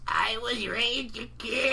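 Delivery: slow, lightly voiced grandma. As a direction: say it slowly as a grandma. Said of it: "the grandma voice".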